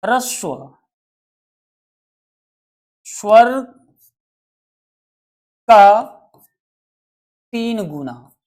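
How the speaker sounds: ह्रस्व स्वर का तीन गुना with long pauses between words; slow spaced-out phrase. Shown as a demonstration. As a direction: space out each word slowly for emphasis. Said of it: "यहाँ पे ह्रस्व". (धीरे-धीरे रुक-रुक कर)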